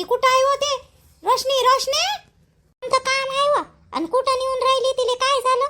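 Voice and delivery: a neighbour woman, pitched high and curious; high neighbour voice asking what's going on; high-voiced neighbour woman. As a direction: play the nosy neighbour, voice lifted high. Same neighbour voice again.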